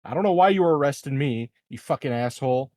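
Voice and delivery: ordinary talk, nothing marked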